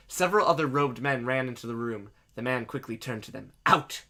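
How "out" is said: loud, abrupt command; ordering. Acted. Man orders robed men from the room, commanding. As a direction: order everyone out in one word. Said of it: "he ordered".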